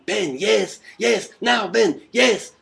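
high, loud, urgent mock shouting; angry Argentinian producer. Argentinian producer behind the reporter demanding a question, angry shouting. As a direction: yell orders like an impatient producer. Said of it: "He's angry".

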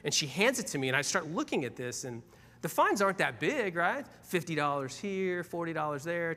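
ordinary talk

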